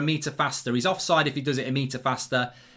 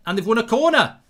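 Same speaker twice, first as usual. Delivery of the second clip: higher, louder burst of excitement; excited commentary; 6 semitones higher, 6 dB louder than usual. Real, not acted. Watchalong host telling viewers of a corner, excited. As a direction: call it out excitedly.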